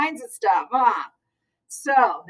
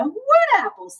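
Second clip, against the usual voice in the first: put-on confused child; 11 semitones higher than usual. A narrator's reading. Child confused about apples.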